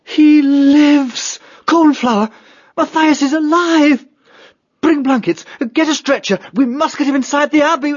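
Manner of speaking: high, loud cry of joyful relief; excited, high-pitched and urgent; elated urgency. Acted. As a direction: announce a miracle, then rush out orders. Abbot finds Matthias alive.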